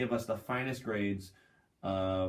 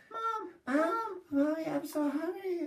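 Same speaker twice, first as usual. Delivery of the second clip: put-on much higher, childish whine to mom; whiny child. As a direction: play a hungry little kid calling his mother. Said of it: "things that we would yell at our parents".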